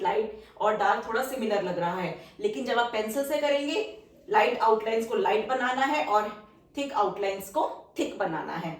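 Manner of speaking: ordinary talk, nothing marked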